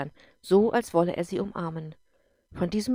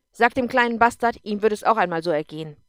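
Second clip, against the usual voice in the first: louder, fast contemptuous jab; spiteful drunk; 2 semitones higher, 8 dB louder than usual. Acted. Drunk husband sneering at the stepson to his wife, loud and contemptuous. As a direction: spit the insult at the boy's expense.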